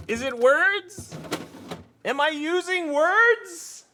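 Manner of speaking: high, slow cartoon voice, playful; silly squeaky voice. Shown as a demonstration. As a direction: squeak it out slowly, mock-baffled. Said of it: "I don't even know what I'm saying".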